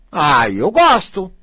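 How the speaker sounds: slow, loud, savoring "ai, eu gosto"; drawn out with relish; delight. Real, not acted. A coach savoring work that goes well, relishing.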